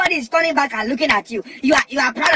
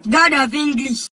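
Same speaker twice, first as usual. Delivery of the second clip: ordinary talk, nothing marked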